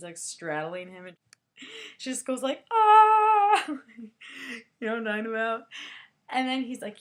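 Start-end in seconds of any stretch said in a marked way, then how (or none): 2.7-3.6 high, loud battle cry; warrior yell. Retold or imitated. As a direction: belt it out like a warrior striking. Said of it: "warrior pose".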